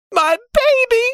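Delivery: very high, panicked mother's wail; anguished cry. A narrator's reading. Mother outside the locked safe, crying out in terror. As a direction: cry out at the top of the pitch range. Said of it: "her mother cried".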